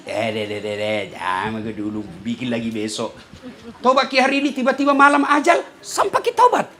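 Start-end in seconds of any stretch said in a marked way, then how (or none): 0.1-3.2 low, soft devilish whisper; hushed, much lower tempter voice. Acted. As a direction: murmur the temptation like Satan at your ear. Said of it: "setan bisik ki".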